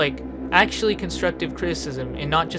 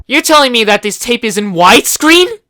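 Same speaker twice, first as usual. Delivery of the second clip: incredulous disbelief; 6 semitones higher, 11 dB louder than usual. Real, not acted. Mocking the widescreen tape.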